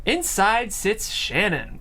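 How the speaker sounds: ordinary talk, nothing marked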